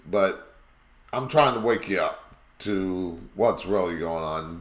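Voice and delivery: ordinary delivery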